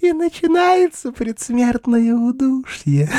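high, slow mocking voice; taunting witch voice. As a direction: draw out the threat high. Ulrica taunts dying knight.

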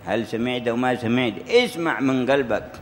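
ordinary talk

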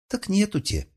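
put-on high childlike voice; little girl voice. A narrator's reading. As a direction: answer like a small girl.

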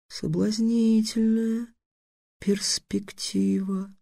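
slow, soft musing; thoughtful. A narrator's reading. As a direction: weigh it over slowly. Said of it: "задумчиво сказал Сфинкс".

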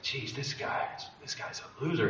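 whispered, hushed aside; whispered thought. Retold or imitated. Judging a supposed friend in his head, whispering. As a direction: whisper it to yourself in disbelief. Private thought during a vision.

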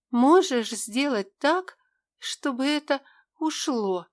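helpless pained moan; бессильный стон. Acted. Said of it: "в бессилии простонала я". (простонала в бессилии)

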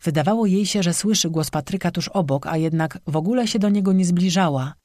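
ordinary delivery, nothing marked

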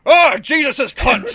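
put-on old man yelling as his paper flies off; old man cursing. Old man in the park shouting at the wind, loud. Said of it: "he just goes".

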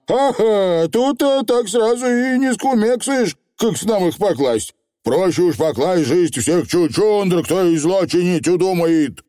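high, chattering dialect; sly Feegle. A narrator's reading. Feegle answering the witch, high and bloodthirsty. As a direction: reason it out like a cheerful rogue.

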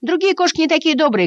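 очень высокий детский голосок; затараторить тоненько, как мышонок; галдящие мышата. A narrator's reading. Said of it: "снова загалдели все". (мышата наперебой хвалят котёнка)

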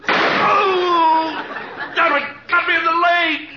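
panicked yelling. Acted. Sketch, caught in the crossfire.

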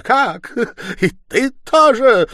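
high, loud, drawn-out challenge; outraged disbelief. A narrator's reading. Stepmother spotting the landlady, shouting in outrage. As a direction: round on a new enemy at full voice.

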